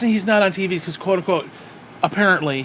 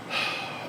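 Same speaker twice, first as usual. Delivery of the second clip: real long sigh of frustration; exasperated sigh; 11 dB quieter than usual. Real, not acted. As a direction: let out an exasperated breath. Frustrated pause in rant.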